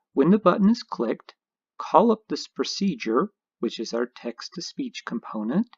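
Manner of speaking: ordinary talk, nothing marked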